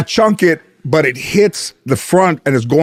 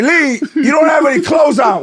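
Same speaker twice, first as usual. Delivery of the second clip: much higher, shocked mock wife; 11 semitones higher than usual. Wife catching Lee naked outside, high and shocked. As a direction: shriek it like a scandalised wife. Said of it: "my wife was like".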